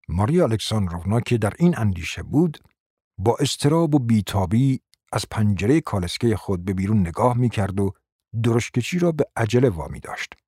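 ordinary delivery, nothing marked

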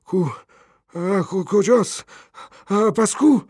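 high, loud, startled questions; panicked. A narrator's reading. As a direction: wake in fright, voice jumping up. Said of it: "مرد با ترس و وحشت".

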